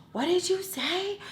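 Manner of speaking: high, drawn-out rising question; slow, high-pitched challenge; challenging. Retold or imitated. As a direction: confront them in a high incredulous pitch. Challenging the neighbor.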